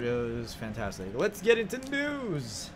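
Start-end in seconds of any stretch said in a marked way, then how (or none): 1.1-2.7 drawn-out show host call; announcer voice. Acted. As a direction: announce the segment grandly. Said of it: "announcing news segment".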